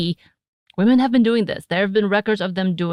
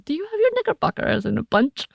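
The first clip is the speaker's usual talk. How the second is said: mock teasing; 9 semitones higher, faster than usual. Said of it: "pepper that in".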